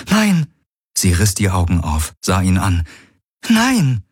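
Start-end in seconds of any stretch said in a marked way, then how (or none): 0.1-0.5 high, sharp cry of refusal; anguished high cry; cried out no. Acted. She cries out in protest. 3.5-4.0 high, sharp cry of refusal; anguished high cry; cried out no. Acted. She cries out again.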